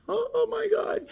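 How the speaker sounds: very high, soft "oh my God"; exasperated dismay. Real, not acted. Telling viewers about the next driver, exasperated dismay. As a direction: squeeze it out high and thin, appalled.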